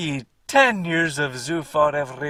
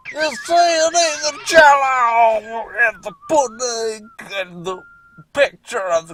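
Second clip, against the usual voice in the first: Bill Cosby impression; 8 semitones higher, 9 dB louder than usual. [name] lecturing about Christmas, in a loud high put-on voice. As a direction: ham up a comedian's rambling lecture. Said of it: "[name] being Bill Cosby".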